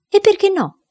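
high, eager exclamation; exclaiming. A narrator's reading. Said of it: "esclamò il Maralli". (esclamazione acuta e entusiasta)

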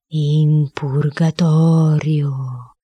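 very slow, drawn-out ghostly reply; thread of a voice. A narrator's reading. Boy faking the dead founder's answer, in a thin slow voice. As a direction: stretch every syllable like a faint spirit. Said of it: "risposi con un fil di voce".

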